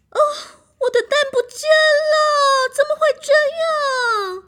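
high, loud crying shriek; shrieking hen. Acted. Hen finds eggs gone, crying shriek. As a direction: shriek in dismay. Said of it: "母鸡阿姨的尖叫声".

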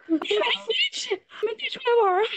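high-pitched laughing reaction. Real, not acted. Cracking up at a friend's priciest purchase, laughing. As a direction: say it through laughter, pitch shooting up.

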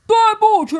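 high, loud shouted 对不起; loud apology. A narrator's reading. Bear shouting sorry inside the fox's den. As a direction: bellow the sorry. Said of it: "大声地说".

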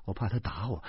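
ordinary delivery, nothing marked

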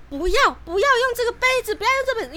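put-on high anxious pleading to boyfriend; frightened plea. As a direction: plead in fright not to use the glass. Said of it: "因为我很害怕".

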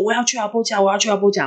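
high, stubborn repeated protest; retold struggling insistence. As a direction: act out someone struggling to get away.

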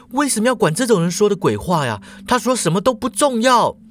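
rising to a frightened high pitch, rushed; fast and agitated. A narrator's reading. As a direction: brush it off in a panic, voice climbing. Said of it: "他说得很快啊，语气激动". (急促激动，声音越说越高)

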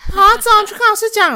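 high, alarmed schoolkids fretting and tattling; panicky kid crowd. Retold or imitated. Classmates panic over crying boy, high alarmed kid voices. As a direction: squeal it like worried classmates. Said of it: "然后群众就说".

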